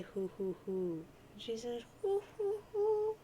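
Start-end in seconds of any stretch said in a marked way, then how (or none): 2.0-3.2 very high, airy owl-like hoots; high hooting. Acted. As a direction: hoot playfully in a high grandmother voice. Nana hoots in reply.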